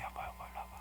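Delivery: soft calling whisper. Shown as a demonstration. Jacob quietly calling his wife over, hushed whisper. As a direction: whisper it gently, almost no voice. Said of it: "차근 조곤조곤하게 조용하게".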